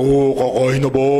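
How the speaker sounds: siblings mocking a deep voice; low, boomed-out teasing line. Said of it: "niloloko-loko ako ng mga kapatid ko". (ginagaya ang malaking boses ng kapatid)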